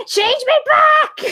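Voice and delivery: high, loud, slow demand; mock shout. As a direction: yell it as a furious little muffin.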